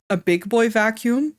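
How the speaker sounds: usual speaking voice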